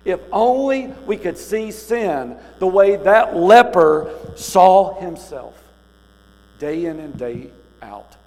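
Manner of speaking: high, loud and slow impassioned plea; preaching at full force. Acted. Preacher urging the congregation to see their sin, high loud slow plea. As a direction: preach it slowly, pleading with the flock.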